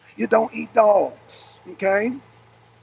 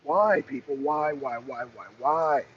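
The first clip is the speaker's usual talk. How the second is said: softer, dropping lament of why, why; despairing why; 6 semitones lower, 6 dB quieter than usual. Acted. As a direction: plead into the void, deflated. Despairing lament over pets.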